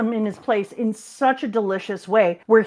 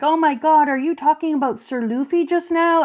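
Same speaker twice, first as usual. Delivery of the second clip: excited gush; 6 semitones higher than usual. Retold or imitated. Girls gush over Luffy talk.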